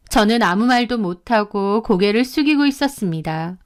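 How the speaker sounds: plain reading voice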